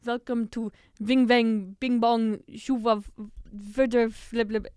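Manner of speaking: slow, loud fake German accent; mangled fake German gibberish. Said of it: "playing this fake German interviewer".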